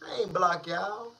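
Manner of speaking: ordinary talk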